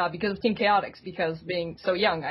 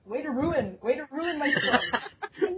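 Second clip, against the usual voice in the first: laughing scold; 8 semitones higher, slower than usual. Real, not acted. Scolding the cat.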